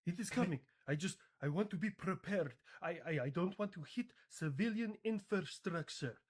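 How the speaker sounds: halting mock Iranian accent. Iran insists it spares civilians, in a halting Iranian accent. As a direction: stumble along in a put-on Iranian accent. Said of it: "so hard to say in this accent".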